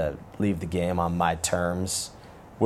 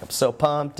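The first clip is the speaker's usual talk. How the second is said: excited; 7 semitones higher, slower than usual. Retold or imitated. Texting excitement, high pitch.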